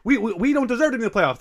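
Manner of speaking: high, whiny pessimistic fan. Retold or imitated. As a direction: whine like a fan who expects to lose. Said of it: "No one wants".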